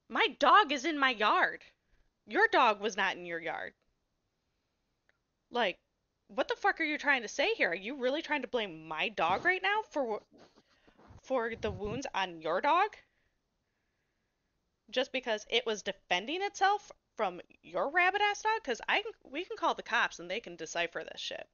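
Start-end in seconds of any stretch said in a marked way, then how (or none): 0.1-3.7 louder and higher, heated comeback; retold angry retort. Woman retelling how she yelled at the neighbor family, loud and angry. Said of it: "I'm like". 6.3-20.2 louder and higher, indignant outburst; heated rant. Retold or imitated. Retelling her fight with the neighbor dad, heated and indignant.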